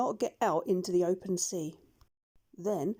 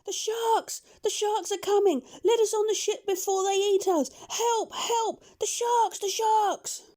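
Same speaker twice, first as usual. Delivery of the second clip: very high, panicked screams for help; terrified cries; 12 semitones higher than usual. A narrator's reading. Pirates in the water crying to the doctor, terrified. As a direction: shriek in terror as the sharks close in. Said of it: "they all cried out in great fear".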